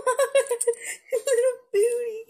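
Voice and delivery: high laughing delight; laughing squeal. Real, not acted. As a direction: laugh through the words.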